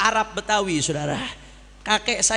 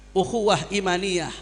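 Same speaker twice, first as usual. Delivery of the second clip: normal talking voice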